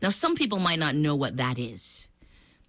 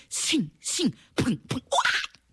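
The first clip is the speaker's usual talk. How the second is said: sound effects with a yell; 7 semitones higher, slower than usual. Acted. Monkey hits crocodile, sound effects.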